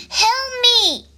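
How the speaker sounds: high, squeaked cry for help; frightened eep. A narrator's reading. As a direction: eep it like a scared frog. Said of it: "eeped Frog".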